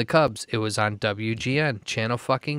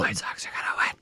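hushed echo of a prediction; conspiratorial whisper; mock secret whisper; 4 semitones lower, 4 dB quieter than usual. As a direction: whisper it, playing along.

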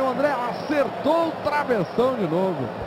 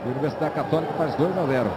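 ordinary delivery

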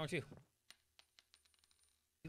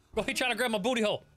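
usual speaking voice

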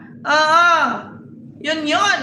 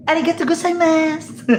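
much higher cutesy mock Japanese thanks; 9 semitones higher than usual. Parodying Japanese women, high cutesy mock Japanese. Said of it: "Ganun pag Japanese".

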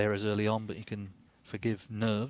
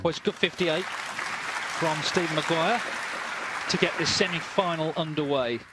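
announcer voice; 6 semitones higher, 4 dB louder than usual. Acted. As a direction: proclaim the frame result like a broadcaster. Commentator announces frame result.

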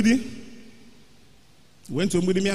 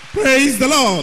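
preacher's call; 7 semitones higher, 5 dB louder than usual. Real, not acted. Call to congregation.

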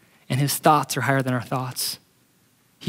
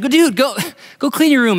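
exasperated dad; 9 semitones higher, 4 dB louder than usual. Acted. Dad tells son again.

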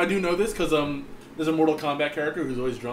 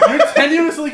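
higher, louder start through laughter; laughing; 12 semitones higher, 10 dB louder than usual. Real, not acted. Finishing a point after a friend's joke, laughing. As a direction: fight through the giggles to finish the line.

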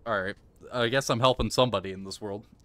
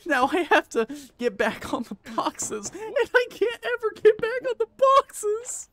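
very high, squealing tearful complaint; despairing whine. Real, not acted. Gamer who must get back on the boxes, despairing wail. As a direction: whine it upward into a falsetto wail.

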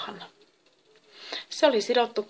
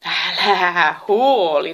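loud, very drawn-out, breezy reassurance; laughing reassurance; 9 dB louder, much slower than usual. A narrator's reading. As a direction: brush the worry off, stretching the words. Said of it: "nauroivat Epimetheus ja Pandora". (huoleton, naurava rauhoittelu)

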